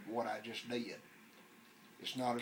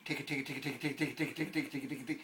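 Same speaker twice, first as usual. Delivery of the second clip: fast tick tick tick, tongue flicking; rapid tongue ticks; 3 semitones higher than usual. Shown as a demonstration. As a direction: rattle off tick tick tick quickly. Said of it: "I'm gonna show you how to do it".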